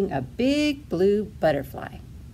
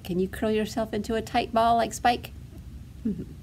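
plain reading voice

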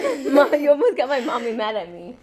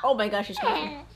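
ordinary talk, nothing marked

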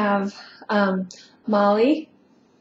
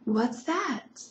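ordinary delivery

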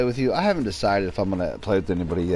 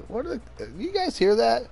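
puzzled self quote. Retold or imitated. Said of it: "and I'm like".

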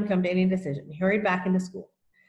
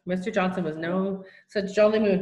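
usual speaking voice

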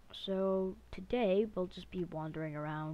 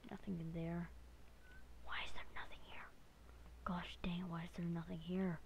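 whispering; 10 dB quieter than usual. Real, not acted. Sneaking in witch's house.

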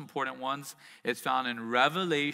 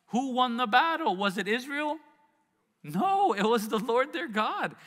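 "No" shouted high and loud, then pressing on; rising preaching fervor; 8 semitones higher, 4 dB louder than usual. Acted. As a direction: build the question, then cry out the answer. Preaching build to climax.

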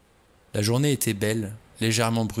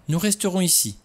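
much higher, relieved joy; delighted; 9 semitones higher than usual. A narrator's reading. Vera happily agreeing to stay outdoors, higher. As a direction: say it gladly, lifting. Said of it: "se réjouit Vera".

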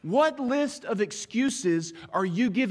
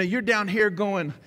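usual speaking voice

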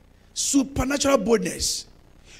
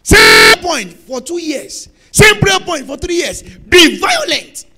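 loud slow shout; fiery exhortation; preaching at full force; 4 semitones higher, 13 dB louder, slower than usual. Acted. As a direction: pound each word from the pulpit.

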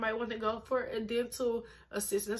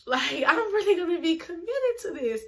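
laughing; 7 semitones higher, 6 dB louder than usual. Real, not acted. Laughing, pitched up promise.

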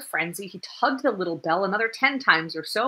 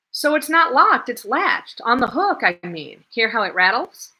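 eager explaining; 5 semitones higher, 7 dB louder than usual. Acted. Young man explaining eagerly.

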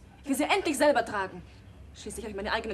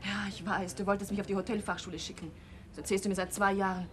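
normal talking voice

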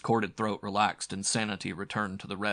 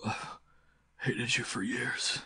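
higher, strained, halting gasp; gasping in pain; 4 semitones higher than usual. A narrator's reading. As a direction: drag the words out through pain. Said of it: "he gasped, writhing".

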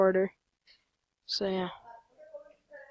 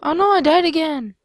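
dismayed cry, higher and louder; gritos de fastidio; 7 semitones higher, 6 dB louder than usual. Real, not acted. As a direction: cry out at losing, pitch jumping up. Dies again in the game.

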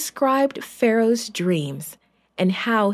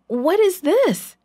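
much higher, slow alarmed cry; startled call; 10 semitones higher, 3 dB louder, slower than usual. A narrator's reading. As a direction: call out in shock at the money. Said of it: "he called to the others".

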